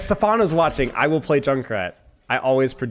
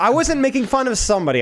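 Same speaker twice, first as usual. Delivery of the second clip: defensive high protest; 8 semitones higher than usual. Real, not acted. Defending himself from teasing.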